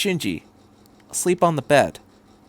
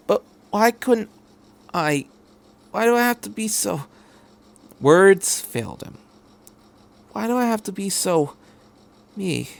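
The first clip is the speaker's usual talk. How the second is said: higher, faltering question; lightly voiced despairing boy; 7 semitones higher than usual. Shinji asking the doctor why, faltering. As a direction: let the words fail.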